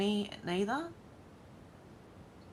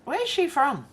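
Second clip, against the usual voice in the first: jumps higher and louder, intrigued; higher where's she from; curious surprise; 8 semitones higher, 4 dB louder than usual. Real, not acted. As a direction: perk up, wondering aloud. Curious about reporter's accent.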